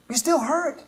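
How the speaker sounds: lifted empathetic repeat. Shown as a demonstration. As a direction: repeat it lifting still upward.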